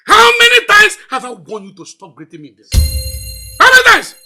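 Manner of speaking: loud, outraged scolding; angry shouting. Acted. Father scolding over an unwanted greeting, angry shouting. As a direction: fire off the question at full shout.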